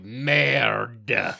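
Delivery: put-on loud, drawn-out hammy French accent; bad French accent. Annoying a fluent sister for fun, bad French accent. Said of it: "words in a bad French accent".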